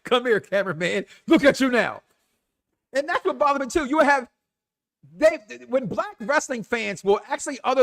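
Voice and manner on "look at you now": louder gloating jab at Look; mocking taunt. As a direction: rub it in, louder.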